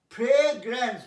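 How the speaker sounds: ফ্রেগ্রেন্স loud and firm; careful pronunciation. Shown as a demonstration. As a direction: push through ফ্রেগ্রেন্স without stumbling. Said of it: "দাঁত যেন না ভেঙে যায়". (জোরে ফ্রেগ্রেন্স)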